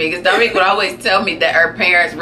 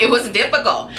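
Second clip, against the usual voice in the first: ordinary talk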